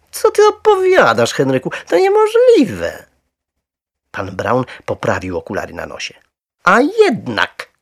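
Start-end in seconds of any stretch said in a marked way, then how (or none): 0.1-3.1 very high, loud scolding disbelief; incredulous. A narrator's reading. Wife to husband who claims he saw a bear, incredulous. 6.6-7.7 loud, high, drawn-out insistence; insistent. A narrator's reading. Husband to disbelieving wife, stubbornly insistent. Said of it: "upierał się".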